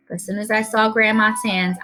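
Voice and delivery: usual speaking voice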